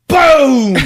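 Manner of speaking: loud, high, booming "boom"; big imitated crash; crash noise. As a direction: explode on the word as the impact.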